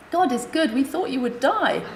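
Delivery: a nurse thanking God she lived; light nurse voice, relieved and moved. Retold or imitated.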